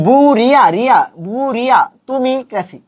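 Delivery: slow বুড়িয়া as "buu-ria", pause, তুমি crazy; broken-up spelling pronunciation. Shown as a demonstration. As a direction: stretch বুড়িয়া slowly, piece by piece. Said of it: "এইভাবে পড়ো, তাহলে দেখবা".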